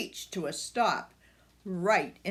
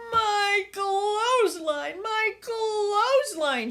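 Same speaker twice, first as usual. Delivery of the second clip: high, slow crying shriek; distraught neighbour shrieking; 13 semitones higher, 5 dB louder than usual. Acted. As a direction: wail it in tearful dismay, much higher and louder. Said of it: "shrieked Edna Fillmore".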